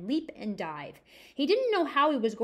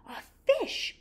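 much higher, excited "A fish!"; excited cry; 13 semitones higher than usual. Acted. Simon spots the eagle's fish, excited cry. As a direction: call out in delight. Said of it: "cried Simon".

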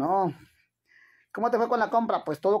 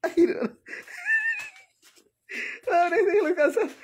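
laugh-crying; 9 semitones higher than usual. Real, not acted. Woman laughs hard at her father, laugh-crying. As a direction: break up laughing till it hurts.

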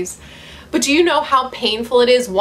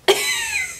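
laughing. Real, not acted. Laughing at book.

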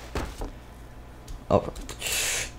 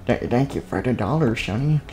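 granny voice; 7 dB quieter than usual. Acted. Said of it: "a weird granny voice".

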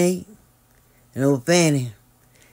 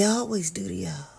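whisper; 9 dB quieter than usual. Real, not acted. Confiding aside.

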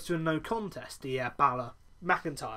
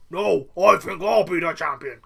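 a louder, put-on boast, said with a different voice; mock boast; 10 dB louder than usual. Third wrestler boasting he'll be champion, mock boast. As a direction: ham up a third wrestler's claim.

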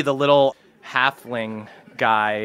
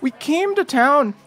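much higher, rambling, stammering voice; higher, flustered halfling with sudden fast bursts; nervous halfling scoutmaster; 11 semitones higher, faster than usual. Acted. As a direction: blurt out the whole sorry story.

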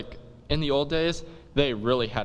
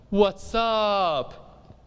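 casual greeting; 8 semitones higher than usual. Acted. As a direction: toss it off like a cool teen. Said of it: "Romeo is like".

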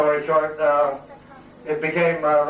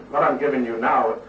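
ordinary delivery

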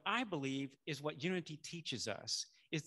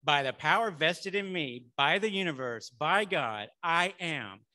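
loud, commanding proclamation; Moses-like authority; 8 dB louder than usual. Acted. Preacher leads the congregation in repeating the declaration, loud and authoritative like Moses. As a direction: proclaim it firmly with full authority. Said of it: "say it with authority. So I'm gonna be like Moses".

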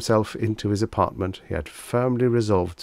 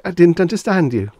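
high, loud, flustered apology; sheepish and rattled; foolish; 11 semitones higher, 9 dB louder than usual. A narrator's reading. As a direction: excuse yourself foolishly, voice up high. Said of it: "he said foolishly".

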